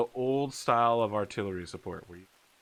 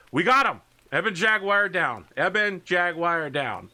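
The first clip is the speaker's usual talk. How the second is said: raised, loud combat callout; triumphant battle report; 5 semitones higher, 5 dB louder than usual. Acted. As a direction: call out a kill over the radio. Pilot reporting a kill mid-battle.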